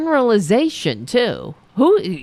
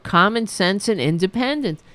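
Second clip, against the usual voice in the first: normal talking voice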